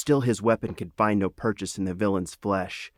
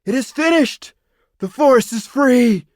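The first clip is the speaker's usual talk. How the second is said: high, loud victorious shout; triumphant cry; 11 dB louder than usual. A narrator's reading. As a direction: proclaim the victory to the whole host. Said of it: "and cried".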